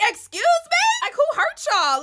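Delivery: high, loud squeal of disbelief; a sky-high indignant "Excuse me?"; mock outrage. Real, not acted. Outraged by finale cliffhanger.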